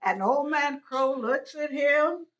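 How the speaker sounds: usual speaking voice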